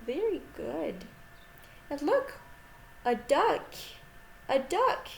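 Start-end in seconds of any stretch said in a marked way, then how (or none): none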